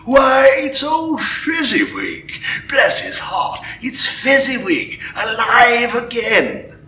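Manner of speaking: high, slow, delighted cry; great excitement. Acted. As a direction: cry out with joy at the sight. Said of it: "Scrooge cried in great excitement".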